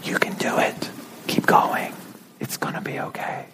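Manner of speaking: soft whispered encouragement; whispering God. Acted. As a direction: whisper reassurance, no voice at all. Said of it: "we don't want a whispering God".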